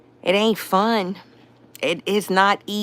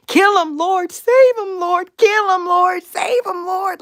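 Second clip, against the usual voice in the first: much higher, loud, wailing pleas to the Lord; imitating her own crying prayer; 13 semitones higher, 4 dB louder, slower than usual. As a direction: wail back and forth between kill and save. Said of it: "and I'm crying, and I'm".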